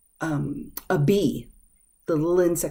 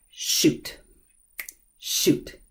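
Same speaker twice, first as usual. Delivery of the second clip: whispered shoot, shoot; 3 dB quieter than usual. Shown as a demonstration. Teacher whispering shoot after a mistake. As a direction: hiss it under your breath. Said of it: "an expression of".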